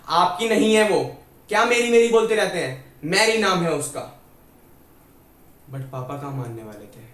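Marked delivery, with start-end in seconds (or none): 0.1-4.2 shouted correction. Retold or imitated. Mother correcting father over the nurse's name, shouting. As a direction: yell it as an indignant wife. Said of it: "mummy ने पापा को चिल्ला के correct करा".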